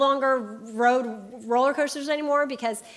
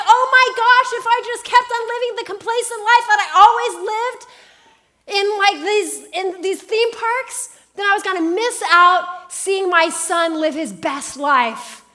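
awe breaking over you; stunned realization; 6 semitones higher, 4 dB louder than usual. Retold or imitated. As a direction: mom realizes on the ride, awed. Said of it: "I'm like".

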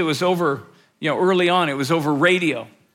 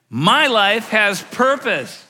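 My life has purpose said slow, higher and louder, each word punched; exclamation point; 3 semitones higher, 4 dB louder, much slower than usual. Shown as a demonstration. Pastor demonstrates the sentence with an exclamation, loud and slow. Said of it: "with that exclamation point at the end".

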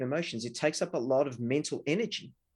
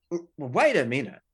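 taken aback; 6 dB louder than usual. Retold or imitated. Defensive reply to accusation.

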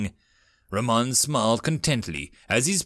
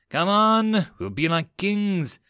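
confident; 8 semitones higher, slower than usual. Acted. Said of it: "Raman said confidently".

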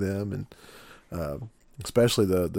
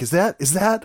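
high, breathless double-take; stunned disbelief; 10 semitones higher, 5 dB louder than usual. Retold or imitated. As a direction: gasp it out in shock, higher. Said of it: "and she's like".

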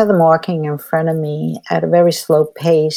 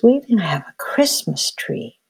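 put-on a small child's hushed whisper; sad whisper; 3 dB quieter than usual. Retelling a three-year-old's confession on a walk, hushed whisper. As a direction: whisper it like a sad little boy. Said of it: "He says".

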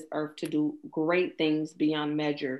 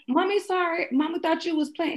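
higher, tender sorry to a little one; apologizing to her child; 12 semitones higher, 4 dB louder than usual. Retold or imitated. Mother apologizing to her daughter, high and tender. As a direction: soften it like talking to your baby. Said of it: "And it was like".

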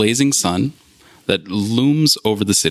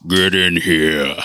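slow, low gruff shout; gruff voice from inside; 4 semitones lower, slower than usual. Acted. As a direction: call them in, gruff and slow. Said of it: "a voice says from inside".

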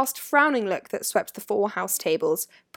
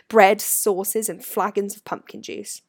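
ordinary delivery, nothing marked

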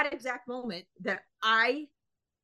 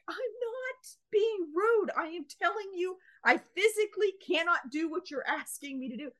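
exasperated protest; 9 semitones higher than usual. Retold or imitated. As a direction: squeak it out, at wit's end. Exasperated retort to clerk.

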